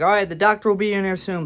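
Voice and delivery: loud, rushed, high mock dismissal; really rude. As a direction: rattle it off like a rude nurse. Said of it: "being really freaking rude".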